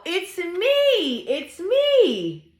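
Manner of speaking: high, sing-song reveal with stretched ME; gleeful high animal voice for kids; excited cow. Acted. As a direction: announce yourself gleefully as the cow.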